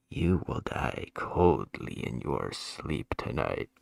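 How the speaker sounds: low, slow, menacing spooky whisper-voice parody; a hammed-up sinister low voice, slow and looming; creepy ominous voice. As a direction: sink low and drag it out like a horror villain. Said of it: "just be like".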